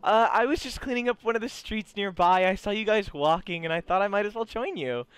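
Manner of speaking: acted high-pitched female character; chipper schoolgirl character. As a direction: greet brightly and explain in a high voice. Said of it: "she turns and she goes".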